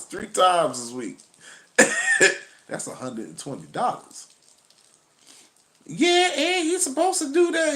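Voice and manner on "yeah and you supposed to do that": much higher, indignant woman caricature; high sassy woman impression; high mocking woman voice.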